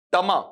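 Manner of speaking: "टमा" as tuh-MAA, weak first vowel; corrected first syllables. Shown as a demonstration. Teacher building the word for students, corrected "tuh-maa". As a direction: say "टमा" with a short uh and long aa. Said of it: "इसका उच्चारण गौर करना क्या बनेगा".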